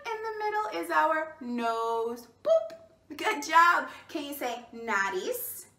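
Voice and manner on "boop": high squeaky boop sound; playful boop. Acted. Teacher booping her own nose for kids, high squeaky boop. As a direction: boop the nose, much higher.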